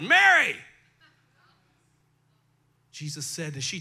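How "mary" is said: Mary! called out much louder and higher; ringing loud call of Mary!; exclamation. Acted. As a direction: shout Mary! like calling someone you love. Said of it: "with an exclamation point".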